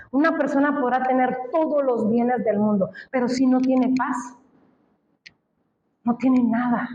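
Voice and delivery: normal talking voice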